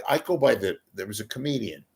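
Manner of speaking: ordinary talk, nothing marked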